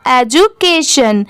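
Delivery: "एजुकेशन" slowly, edju then kay-shun; parts joined slowly. Shown as a demonstration. Teacher putting both parts together, slow careful "एजुकेशन". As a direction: blend "एजुकेशन" from its parts, unhurried. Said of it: "दोनों साथ में मिला देंगे".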